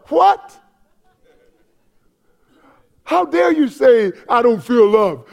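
high, incredulous outburst; mock outrage. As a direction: react as if scandalized by such words. Mock outrage at feeling unloved.

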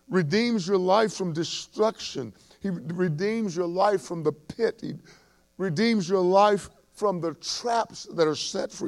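high, loud, ringing psalm proclamation; impassioned sermon recitation, slowing on the big lines; full-force preaching. Acted. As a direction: proclaim the psalm to the church with fervour.

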